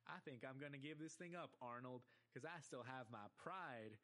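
slow, stretched drawl; Stinky's drawl. Acted. Cartoon kid telling his friend he's quitting, slow drawl. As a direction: drag out the vowels in a cartoon drawl. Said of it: "he speaks in a drawl".

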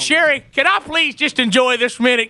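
high-pitched, eager fake politeness; mock pleading. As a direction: beg permission to savour the confrontation. Begging wife to let him confront installer.

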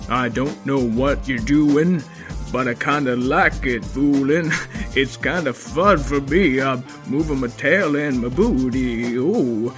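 loud groovy dad; dad getting into the groove. Acted. Father joins song.

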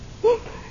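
short, high little laugh; amused laugh. Acted. Cynthia laughs at James wanting company, lightly amused. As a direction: laugh at his odd request.

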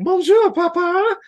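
mock French. Ella greeting her dad, high mock French accent. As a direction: trill it like a hammed-up French girl. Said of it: "Now she's like".

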